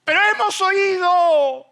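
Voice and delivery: preaching refrain. Acted. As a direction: proclaim it to the congregation. (estribillo predicado a toda voz)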